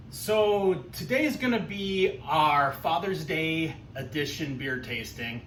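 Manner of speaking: ordinary talk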